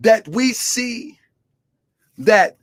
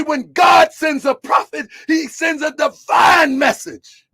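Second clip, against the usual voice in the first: preaching; 3 semitones higher than usual. Real, not acted. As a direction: preach it to the congregation. Preaching a sermon.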